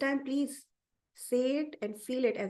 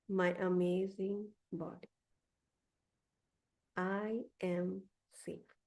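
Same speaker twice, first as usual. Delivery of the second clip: affirmation reading. Acted. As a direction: speak each affirmation gently, like a mantra. Leads listeners through spoken affirmations.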